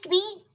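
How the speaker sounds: "knee" as k-nee, questioning; K sounded. Shown as a demonstration. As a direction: pronounce the k at the start of "knee".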